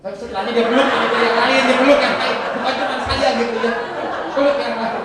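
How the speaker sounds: bursting with laughter while telling; laughing. Real, not acted. Preacher laughing loudly telling the congregation about the hugs.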